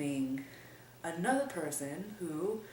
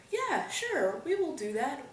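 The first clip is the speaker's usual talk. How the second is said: eager agreement; 8 semitones higher, 4 dB louder than usual. Retold or imitated. Choir eagerly agreeing to sing.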